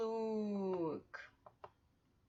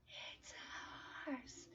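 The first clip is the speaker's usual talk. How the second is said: whispered, far softer; 18 dB quieter, much slower than usual. Shown as a demonstration. Teacher whispering the answer back to the class. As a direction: whisper it like a secret.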